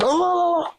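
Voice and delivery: very high startled "oh"; startled gasp. Retold or imitated. Co-host acting someone just woke up, high startled gasp. Acting waking up to being eaten.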